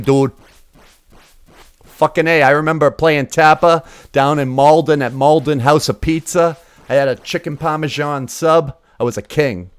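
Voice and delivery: hammed-up Boston accent, emphatic. Gamer bragging about old arcade days, put-on Boston accent. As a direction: reminisce in a thick Boston accent. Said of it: "I'm saying it with my Boston accent".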